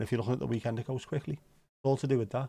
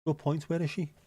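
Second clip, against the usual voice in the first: ordinary talk, nothing marked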